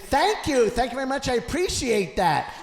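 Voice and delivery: milk the applause; grateful showman. Retold or imitated.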